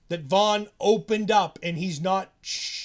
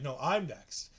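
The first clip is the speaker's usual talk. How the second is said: Tyler's voice, a lower, softer counter; Tyler, lower and quieter; 6 semitones lower, 8 dB quieter, slower than usual. Retold or imitated. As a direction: answer back low and quiet. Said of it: "And [name] like".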